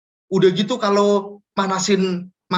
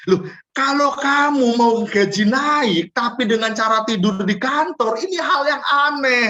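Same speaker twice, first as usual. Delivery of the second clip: incredulous scolding; 8 semitones higher than usual. Acted. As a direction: spell out how absurd it is. Scolding employee's odd logic. (heran dan menegur, pelan dan keras)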